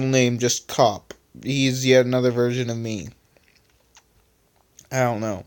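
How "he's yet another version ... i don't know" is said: ordinary delivery, nothing marked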